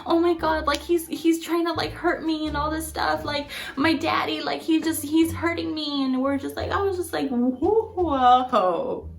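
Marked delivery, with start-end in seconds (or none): 0.1-6.0 high, pleading imitation of panic; retold desperate plea in a raised pitch; freaking out. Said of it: "just freaking out and just like". 7.3-9.1 drawn-out stunned whoa; slow, wary whoa in imitation; taken aback. Acted stunned reaction to the plea.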